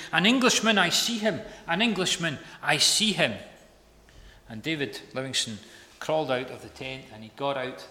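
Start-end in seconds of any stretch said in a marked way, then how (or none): 0.1-3.3 loud, high-pitched, urgent cry; excited announcement. Acted. Servant rushing to tell Livingstone, excited shout. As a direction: call out breathless news with rising excitement. Susie rushes in to report.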